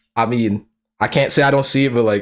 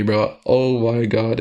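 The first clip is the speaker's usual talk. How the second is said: usual speaking voice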